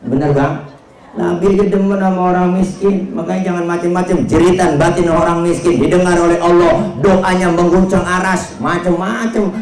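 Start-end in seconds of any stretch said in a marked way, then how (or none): 1.1-9.5 high, insistent warning; forceful preaching. Real, not acted. Preacher warning not to trifle with the poor, high and forceful. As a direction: drive it home to the crowd.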